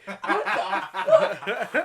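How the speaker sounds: stretched, laughing disbelief; shocked outburst. Retold or imitated.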